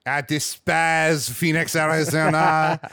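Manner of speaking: slow, drawn-out disdainful character voice; put-on snob. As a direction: play a droll snob, slowly. Put-on snob bit about Phoenix.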